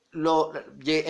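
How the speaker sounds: ordinary talk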